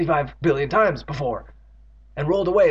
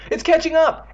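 high, loud panicked warning; scream; 14 semitones higher, 6 dB louder than usual. A narrator's reading. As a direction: scream that the monster is gaining. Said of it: "Andy screamed".

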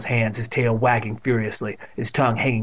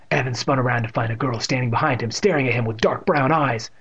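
ordinary delivery, nothing marked

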